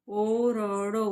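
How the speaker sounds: ओरडो stretched out very slowly, syllable by syllable; slow blended reading. Shown as a demonstration. Teacher reading ओरडो to student, very slow and drawn out. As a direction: draw out ओरडो slowly like reading it aloud for a child. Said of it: "ऐसे आपको याद करना है".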